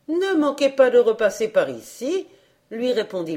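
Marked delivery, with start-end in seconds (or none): 0.1-2.3 hostess. A narrator's reading. Hostess invites him back.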